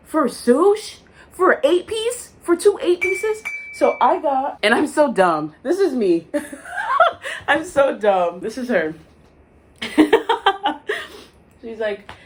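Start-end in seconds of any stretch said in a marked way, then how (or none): 0.1-3.4 high, slow disbelief; outraged at sushi prices; high incredulous squeak. Retold or imitated. 6.3-7.4 high laugh. Real, not acted. Laughing at herself. 9.8-11.2 high laugh. Real, not acted. Laughing at herself.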